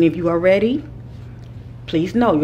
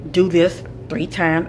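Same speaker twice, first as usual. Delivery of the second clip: ordinary delivery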